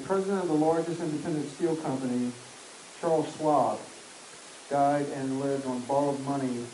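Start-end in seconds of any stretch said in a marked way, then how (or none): none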